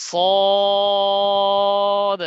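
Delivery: সদ loud, with a long level rounded vowel; heavy, drawn-out. Shown as a demonstration. Teacher leading repeat-after-me, long heavy সদ. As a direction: stretch সদ as thick as you can. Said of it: "যে যত মোটা করতে পারেন".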